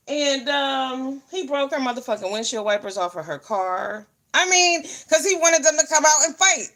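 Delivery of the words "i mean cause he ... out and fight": raised, fired-up retelling; worked up, higher and louder; heated. Real, not acted. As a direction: pitch jumps higher and louder in outrage. Heated retelling of friend's fight.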